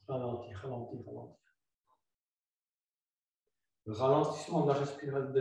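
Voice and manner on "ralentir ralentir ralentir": each ralentir softer, dying away; fading softly. Shown as a demonstration. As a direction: fade out with the exhale. Yoga teacher slowing the exhale. (en ralentissant, voix qui s'éteint)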